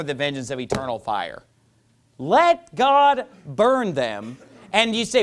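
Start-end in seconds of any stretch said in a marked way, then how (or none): 2.2-4.4 very slow, each word spaced; drawn-out slogan. Acted. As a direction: stretch every word out.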